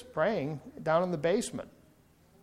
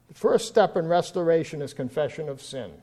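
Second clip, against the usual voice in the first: ordinary delivery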